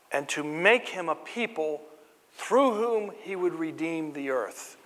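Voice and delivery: slowed, emphatic sermon delivery; preacher building the story of Abraham; measured preaching. Acted. Preaching about Abraham's calling.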